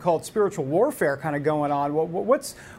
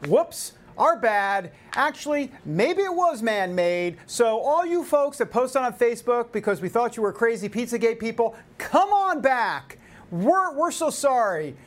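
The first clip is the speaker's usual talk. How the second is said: mock corporate apology; 6 semitones higher than usual. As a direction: exaggerate a fake-contrite climb-down. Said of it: "In other words".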